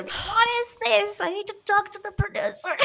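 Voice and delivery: high, mock-indignant complaint; hammed-up huffy casting director; laughing mock outrage at the script; mock outraged. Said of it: "throwing the script in the air".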